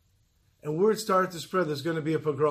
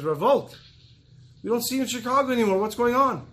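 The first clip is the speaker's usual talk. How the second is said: put-on upbeat, quick and higher, glad to bump into an old friend; a father greeting a rabbi brightly, a bit higher; cheerful greeting; 6 semitones higher, 4 dB louder than usual. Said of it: "he says".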